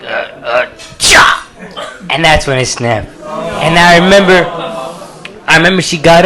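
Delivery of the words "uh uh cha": put-on mild grunting effort of an older lady; old person getting up. Teacher pushing off armrests, mild grunting. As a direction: grunt as you heave up. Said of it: "that thing where they put both hands".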